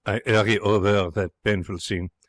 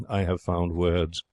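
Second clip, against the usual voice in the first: plain reading voice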